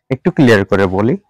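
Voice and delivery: ordinary talk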